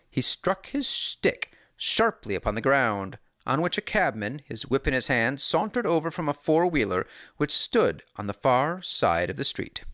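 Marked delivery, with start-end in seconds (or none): none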